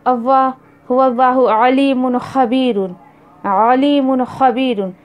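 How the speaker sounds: recited with ain in अलीमुन; measured Quranic recitation. Shown as a demonstration. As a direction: chant it like tilawat. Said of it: "अल्लाह ताला बोल रहे हैं".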